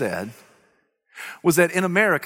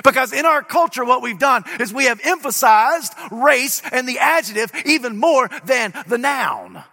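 full-force preaching; 6 semitones higher than usual. Acted. Preacher proclaiming the resurrection to his congregation, full-force preaching. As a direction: preach it hard to the crowd.

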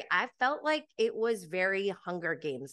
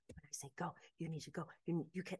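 hushed, very soft pep talk; imitated whispering; 2 semitones lower, 13 dB quieter, faster than usual. Instructor hyping up crouching recruits before the attack, whispering. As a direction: urge them on almost under the breath. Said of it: "he's, like, whispering to them".

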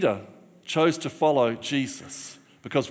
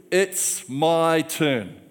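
each word drawn right out; slow, emphatic; 2 semitones higher, much slower than usual. Shown as a demonstration. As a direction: stretch the three words out for the congregation. Teaching the three words to congregation.

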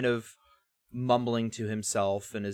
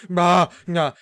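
higher, loud creepy "nah, nah"; creepy relish; 6 semitones higher, 11 dB louder than usual. Retold or imitated. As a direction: savor it like a creep. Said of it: "he's being a super fucking creep".

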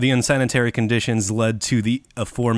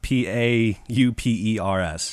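spelled out slowly. Shown as a demonstration. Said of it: "spelling the word out".

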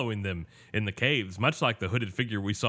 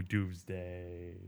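put-on talking to a baby; 3 semitones lower, 8 dB quieter than usual. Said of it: "I don't actually talk like that to an animal".

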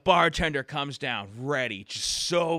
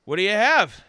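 loud, higher irritated bartender snapping at a customer; 8 semitones higher, 11 dB louder than usual. Acted. Bartender snaps at the duck, loud and high. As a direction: bark the question at the duck.

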